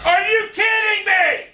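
high-pitched, incredulous shouting; real rage. Real, not acted. Gamer denied a kill, outraged yelling.

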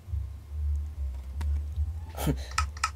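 low unvoiced wheezing laugh; airy shaky laugh; breathless laugh. Real, not acted. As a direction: laugh out the tension. Relieved laugh after scare.

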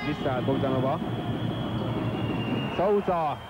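ordinary delivery

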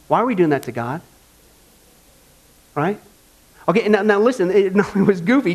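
normal talking voice

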